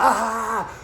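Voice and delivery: high, loud imitated scream; mock scream. Man set on by police dog, mock scream. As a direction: re-enact a scream under attack.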